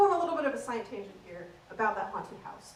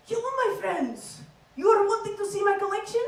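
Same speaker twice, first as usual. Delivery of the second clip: put-on higher, heavily foreign-accented haunted-house character; the Collector; 8 semitones higher, 4 dB louder than usual. Scare actor luring visitors into cages, in heavy accent. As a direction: lure them in with a thick foreign accent. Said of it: "a very distinct, obvious accent".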